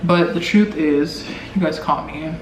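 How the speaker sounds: ordinary talk, nothing marked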